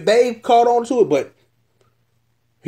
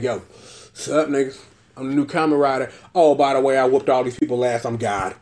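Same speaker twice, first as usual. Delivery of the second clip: cocky low brag; 7 semitones lower than usual. Retold or imitated. New Kamen Rider bragging, retold.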